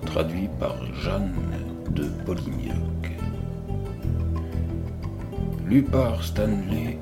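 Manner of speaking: ordinary delivery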